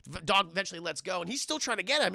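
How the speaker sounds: ordinary delivery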